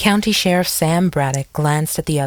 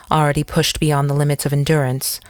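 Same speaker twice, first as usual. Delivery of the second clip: plain reading voice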